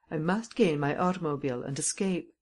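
plain reading voice

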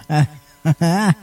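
a sudden burst of real laughter; real giggling fit; helpless giggle. Real, not acted. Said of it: "don't know why I'm laughing like that".

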